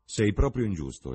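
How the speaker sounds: exclaimed. A narrator's reading. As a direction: exclaim in mock outrage, hitting "proprio". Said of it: "esclamò Lord Henry".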